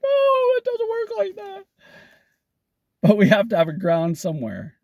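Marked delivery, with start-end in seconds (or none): 0.0-1.7 very high falsetto wail of protest; hammed-up anguished cry; squeaky mock despair; mock crying physicist. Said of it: "He's like".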